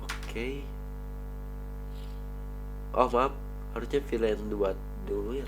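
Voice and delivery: ordinary talk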